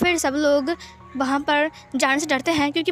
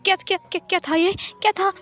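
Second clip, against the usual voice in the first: high, frightened stutter; panicked stammering; 4 semitones higher than usual. Acted. Wang ji sweating after a nightmare, panicked stammering. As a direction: stammer in shock, repeating words in a higher voice. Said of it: "बहुत ज़्यादा डर लग गया".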